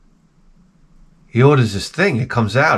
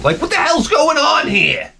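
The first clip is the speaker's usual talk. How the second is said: exasperated outburst; 13 semitones higher than usual. Real, not acted. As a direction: howl it like a wronged man. Outraged complaint about uncaring wife.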